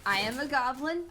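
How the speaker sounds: usual speaking voice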